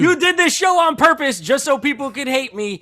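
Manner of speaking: hammed-up indignant outburst; mock outrage. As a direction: protest loudly at being set up. Mock accusation of the host.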